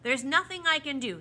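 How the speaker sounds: loud, decisive refusal; firm. A narrator's reading. The proc refuses the children, firm. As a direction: shut the request down firmly. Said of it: "said the proc firmly".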